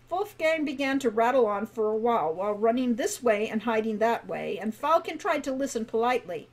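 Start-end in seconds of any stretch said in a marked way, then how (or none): none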